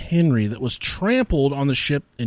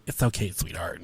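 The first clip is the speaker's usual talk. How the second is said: soft, lower, gentle coo; mock tender; 10 semitones lower, 10 dB quieter than usual. Drunk man to embarrassed boy, mock tender and soft. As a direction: imitate a big man suddenly going sweet and soft. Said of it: "And he didn't talk like that".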